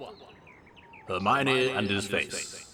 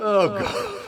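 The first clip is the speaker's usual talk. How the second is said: breathless after laughing; 9 semitones higher than usual. Real, not acted. Reader recovering from a laughing fit, breathless. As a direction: gasp it out still giggling.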